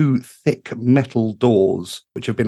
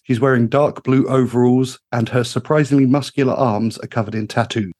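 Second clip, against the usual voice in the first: ordinary delivery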